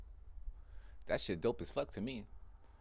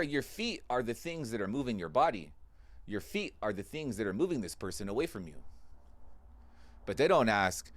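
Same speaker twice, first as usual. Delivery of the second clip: normal talking voice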